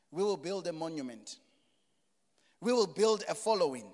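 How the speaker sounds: usual speaking voice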